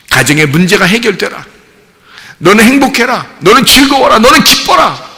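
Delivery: preacher's cadence, climbing to a high-pitched blessing; fervent preaching. Acted. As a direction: proclaim each promise with rising fervour. Preaches Jesus's promise, building in fervour.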